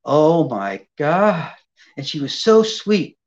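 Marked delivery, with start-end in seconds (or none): 0.0-1.5 low, slow melting sighs; overwhelmed gush. Retold or imitated. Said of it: "She said".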